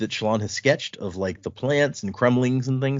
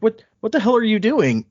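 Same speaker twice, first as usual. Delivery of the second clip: higher, louder mock alarm; alarmed yell; 11 semitones higher, 5 dB louder than usual. Guard yelling at his charge for turning back, higher and louder. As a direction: snap at her in disbelief. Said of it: "He's like".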